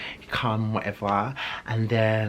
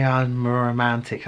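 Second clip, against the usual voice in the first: normal talking voice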